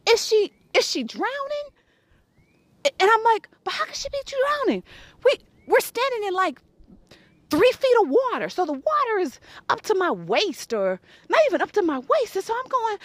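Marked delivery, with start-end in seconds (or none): 0.1-8.5 incredulous. Retold or imitated.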